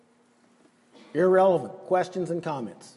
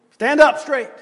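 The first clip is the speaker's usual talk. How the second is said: firm command; 5 semitones higher, 5 dB louder than usual. Retold or imitated. Jesus commanding.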